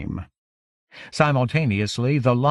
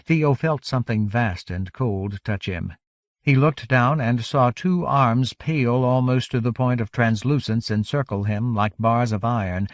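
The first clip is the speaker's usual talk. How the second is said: ordinary delivery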